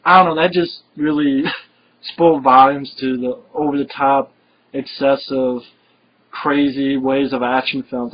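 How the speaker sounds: ordinary talk, nothing marked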